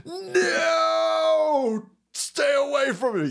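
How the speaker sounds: high, drawn-out mock child shout; frightened kid. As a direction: imitate a kid crying out to be left alone. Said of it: "this kid goes".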